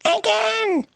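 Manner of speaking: put-on very high delighted baby cry; high gleeful toddler shout. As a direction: squeal it like a thrilled toddler. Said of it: "The baby laughs and shouts".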